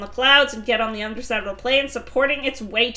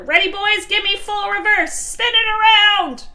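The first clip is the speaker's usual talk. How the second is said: shouted command; 9 semitones higher, 5 dB louder than usual. Acted. Leader to bees landing the plane, high shouted orders. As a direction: call out commands, stretching the last one.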